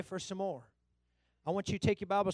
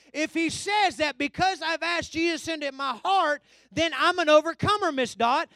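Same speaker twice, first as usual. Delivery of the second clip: raised pulpit voice, declaring it firmly; impassioned preaching; 9 semitones higher, 11 dB louder than usual. Real, not acted. Preacher declaring faith in the Word to the congregation, impassioned. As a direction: declare it to the whole church.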